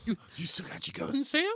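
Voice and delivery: low, rough, drugged-out voice; heron voice. Real, not acted. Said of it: "That's your heron voice".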